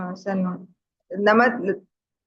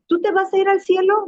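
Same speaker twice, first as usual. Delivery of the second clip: questioning voice, pitched up high; mock high question; 11 semitones higher, 4 dB louder than usual. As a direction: imitate the eager questioner. Cristianos interrogan a la monja. (pregunta imitada, aguda)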